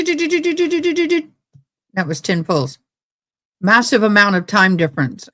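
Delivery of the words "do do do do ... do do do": rapid trigger pull sounds. Retold or imitated. Imitating trigger pulls.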